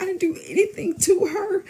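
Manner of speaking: very high, whimpering put-on voice; mock tearful denial. Man denying he did anything to her, mock crying.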